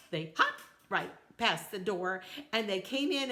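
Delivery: usual speaking voice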